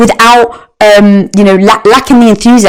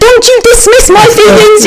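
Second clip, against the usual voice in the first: higher, louder, mock indignant snap; indignant outburst; 3 dB louder than usual. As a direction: replay your touchy protest, hammed up. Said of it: "of course, I was sensitive".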